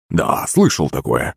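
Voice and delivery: low, slow grumble; lightly voiced grumpy grandfather; grumbling. A narrator's reading. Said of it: "пробурчал дед".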